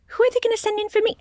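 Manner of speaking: Wheel of Fortune voice. Acted. Wheel of Fortune wonders who's coming, high fast voice. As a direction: squeak it out like a nervous game wheel. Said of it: "the Wheel of Fortune is like".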